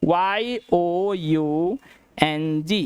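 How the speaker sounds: very slow, drawn-out spelling of वाई ओ यू एन जी; teacher spelling out yang; spelling slowly. Shown as a demonstration. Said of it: "एक word लिखते हैं young".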